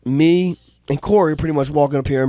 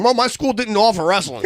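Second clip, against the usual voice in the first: Roger imitation; 7 semitones higher than usual. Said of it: "like Roger saying".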